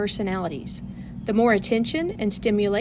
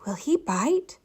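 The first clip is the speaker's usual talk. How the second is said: higher, timid little question; nervous; 5 semitones higher than usual. A narrator's reading. Child nervously asking teacher about the pet, timid. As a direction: ask it nervously, a bit afraid. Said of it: "asked Tanisha nervously".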